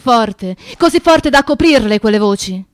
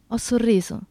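usual speaking voice

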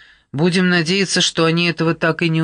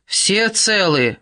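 drawn-out, higher-pitched call; 6 semitones higher, slower than usual. A narrator's reading. Nat checks on everyone after the raft crashes down, calling out. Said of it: "крикнул Нат".

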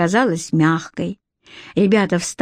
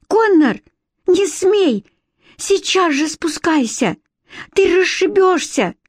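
high-pitched alarmed warning; urgent pleading; 9 semitones higher than usual. A narrator's reading. Alex tries to talk Conner out of jumping, alarmed. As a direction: urge him down before he jumps. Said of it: "уговаривала его Алекс".

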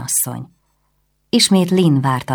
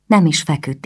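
usual speaking voice

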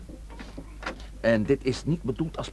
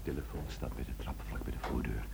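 much softer, low breathy whisper; barely a breath so no one hears; 11 semitones lower, 14 dB quieter than usual. Acted. Chief whispering directions inside the suspect's house.

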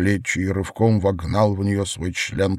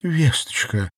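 higher-pitched sighing call; sighed name; 7 semitones higher than usual. A narrator's reading. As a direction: sigh out the child's name. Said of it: "вздохнула Надежда".

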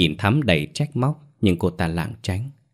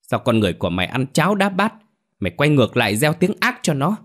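higher, quick angry tirade, narrated; furious yelling; 6 semitones higher, faster than usual. A narrator's reading. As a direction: scold hotly, pitch climbing as you go. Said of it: "nổi điên, hét lớn".

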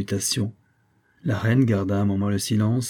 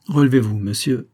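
severe command, louder; stern; 2 semitones higher, 6 dB louder than usual. A narrator's reading. Queen ordering the kneeling officer up, stern and loud. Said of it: "reprit la reine avec sévérité".

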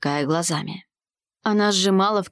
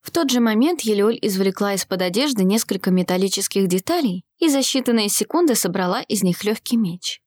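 ordinary delivery, nothing marked

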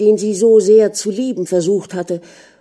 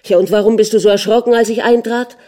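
quick, louder, higher demand; jealous interrogation; 4 semitones higher, 6 dB louder, faster than usual. A narrator's reading. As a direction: fire the question at her suspiciously. Jealous husband interrogates his wife.

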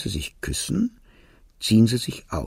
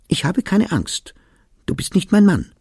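high-pitched, emphatic defiance, read in narration; defiant retort; 9 semitones higher, 4 dB louder than usual. A narrator's reading. Woman telling her armed fiancé to his face, defiant. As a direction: throw it back at him, pitched well up. Fiancée defies him in a quarrel.

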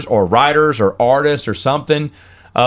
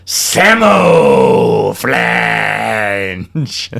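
drawn-out shout; 3 semitones lower, much slower than usual. Real, not acted. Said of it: "That felt good".

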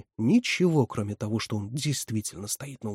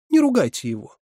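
high, louder tearful plea; sobbing; 10 semitones higher, 6 dB louder than usual. A narrator's reading. Катерина begs Ольга Павловна not to scold, tearful. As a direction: plead for him, voice raised as if near tears. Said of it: "всхлипнула Катерина".

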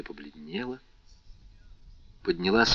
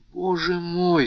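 high pleading exclamation; anguished cry; 10 semitones higher than usual. Acted. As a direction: cry out in alarm for the fallen man. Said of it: "вскричала Валентина". (вскрик, высоко и громко)

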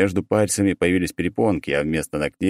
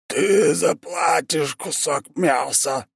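gurgling; 10 semitones higher, much slower than usual. Acted. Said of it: "пробулькал одержимый".

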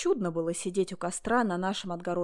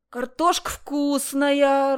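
slow, louder considered verdict; measured approval; judicious verdict; 6 semitones higher, 8 dB louder, much slower than usual. A narrator's reading. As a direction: weigh the taste out loud. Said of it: "рассудительно сказал он".

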